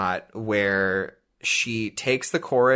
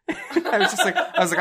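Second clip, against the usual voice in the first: laughing; 9 semitones higher than usual. Real, not acted. Laughing while retelling.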